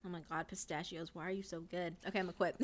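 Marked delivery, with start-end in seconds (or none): none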